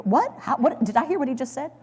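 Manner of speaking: stunned disbelief. Pretend. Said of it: "try this, like".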